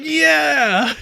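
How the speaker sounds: high, loud gleeful cheer; villain cheering himself, loud and high; delighted villain. Retold or imitated. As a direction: crow with self-satisfied joy. He's really happy with himself.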